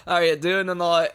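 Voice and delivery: loud, hammed-up Australian greeting; Aussie accent. Greeting a new patron for a ringtone, mock Aussie accent. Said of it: "the Aussie version".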